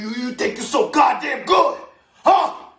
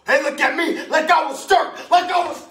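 raging father; 12 semitones higher, 10 dB louder than usual. Acted. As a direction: stammer building into a high, loud shout. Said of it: "telling her all about his woman, saying".

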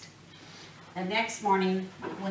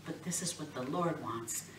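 ordinary delivery

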